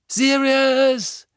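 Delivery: high, loud, drawn-out cry of a name; anguished call. Acted. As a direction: call after him in despair. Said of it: "rief Harry". (Harry schreit verzweifelt nach Sirius)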